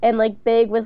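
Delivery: ordinary talk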